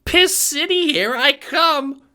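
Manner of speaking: very high, loud, slow gleeful cry; excited squeal. Acted. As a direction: cheer it like a giddy kid. Ace cheering excitedly.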